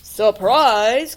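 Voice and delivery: loud pouncing wolf cry; wolf's shout. Acted. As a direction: leap out with a shout. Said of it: "cried the wolf".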